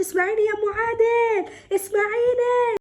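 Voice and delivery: high, drawn-out wailing plea; imitated screaming plea. Mother retelling the caller's pleading, imitated screaming. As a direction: mimic her screaming, stretching the words. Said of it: "راحت قعدت تصرخ".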